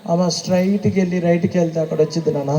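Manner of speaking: quiet uncle giving directions. Retold or imitated. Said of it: "అని చెబుతాడు".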